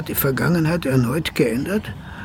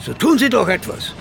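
desperate shouting. Acted. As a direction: call out to Wels in despair. Desperate calls to Wels.

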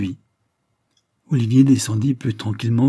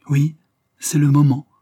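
louder, higher urgent cry; rising emotion; 5 semitones higher, 6 dB louder than usual. A narrator's reading. Olivier urging Blanche in the prison, rising emotional cry. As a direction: insist with growing feeling. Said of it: "s'écria Olivier avec une émotion croissante".

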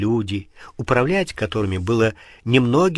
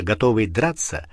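usual speaking voice

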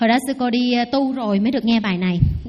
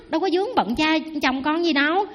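usual speaking voice

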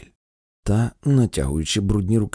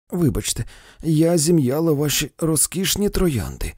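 cried out; 6 semitones higher, 3 dB louder than usual. A narrator's reading. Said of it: "зойкнула Хауксбі".

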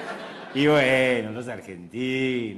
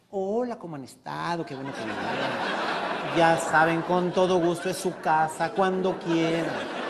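welcoming host; 4 semitones higher, 5 dB louder, slower than usual. Acted. Sea welcoming visitors, voiced.

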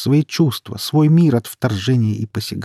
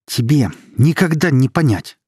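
loud, raised angry retort; heated, higher-pitched outburst; full of anger; 6 semitones higher, 5 dB louder than usual. A narrator's reading. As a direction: throw it in their face angrily. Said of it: "переполненный злостью".

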